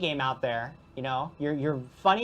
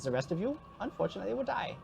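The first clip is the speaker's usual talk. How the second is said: a lightly put-on foreign ghost accent, cheerful and gloating; Alvin the Ghost accent. Ghost NPC gleefully presenting prizes to the chat, mild put-on accent. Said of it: "lose his accent halfway through".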